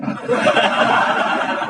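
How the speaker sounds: high burst of laughter. Real, not acted. Preacher laughs.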